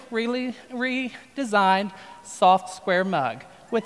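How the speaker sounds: "soft" leaned on slowly and drawn out; slow down and punch "soft"; stress on soft. Shown as a demonstration. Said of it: "the emphasis on the word soft".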